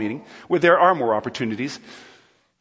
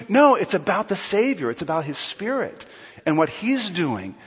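ordinary talk

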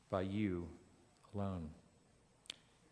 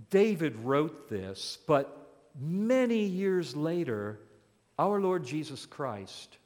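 ordinary talk, nothing marked

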